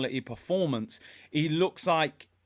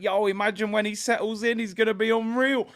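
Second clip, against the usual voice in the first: higher-pitched, hyped-up fan quote; eager, raised-pitch imitation of fans; retold fan excitement; 6 semitones higher than usual. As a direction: imitate fans buzzing about a new player. Retold fans' excited reaction.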